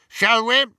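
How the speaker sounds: high, loud character voice; brisk invitation. A narrator's reading. Travers urging Hermione forwards, high and loud. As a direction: pitch it up, loud and sudden.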